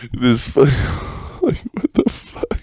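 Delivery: breathless giggling whisper; whispered laugh. Real, not acted. Laughing at his own memory of assuming German, whispered. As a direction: laugh it out under your breath.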